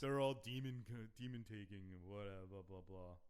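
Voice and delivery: loud, slow hammed-up zealot; mock preacher. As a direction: rant like a doomsayer. Imitating the religious NPC.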